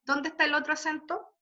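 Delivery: normal talking voice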